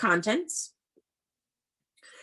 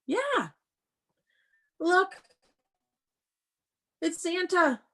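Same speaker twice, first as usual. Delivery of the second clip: much higher, bright show-and-tell reveal; delighted discovery; 11 semitones higher, slower than usual. Acted. Teacher showing the class Santa in the book, delighted. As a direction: point at the picture in wonder.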